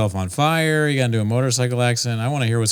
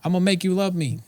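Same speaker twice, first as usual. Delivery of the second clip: high, lisping Tyson voice; Mike Tyson impression; 8 semitones higher, faster than usual.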